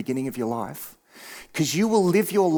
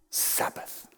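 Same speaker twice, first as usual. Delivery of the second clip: whispered; 11 dB quieter than usual. Real, not acted. Whispered key word.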